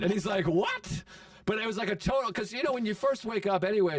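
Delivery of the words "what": high-pitched incredulous yelp of a question; mock shocked disbelief. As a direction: act out a buddy's stunned reaction, pitch shooting up. Retold buddy's shocked reaction.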